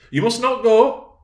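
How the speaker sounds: high, loud, drawn-out forbidding; insistent shout, slowed for weight; energetic command. Acted. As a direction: forbid it with full force. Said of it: "she replied energetically".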